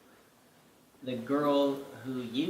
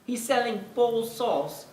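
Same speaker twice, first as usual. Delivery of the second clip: high, louder, incredulous official voice; baffled official; 9 semitones higher, 4 dB louder than usual. Retold or imitated. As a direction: play a baffled British regulator. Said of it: "British Food and Drug Administration would be like".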